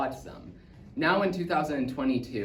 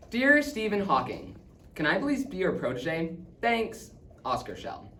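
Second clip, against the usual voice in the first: put-on higher-pitched boy reciting his letter; hopeful fan letter; 6 semitones higher than usual. Boy writing to Stephen Hawking, hopeful and eager. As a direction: read it out eagerly, dropping at the sign-off.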